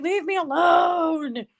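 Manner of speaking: high, drawn-out mock wail; exasperated plea. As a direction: stretch it into a slow, whining cry. Acted plea to clinging son.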